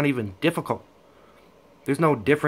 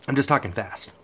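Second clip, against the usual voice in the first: usual speaking voice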